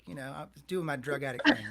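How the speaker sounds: normal talking voice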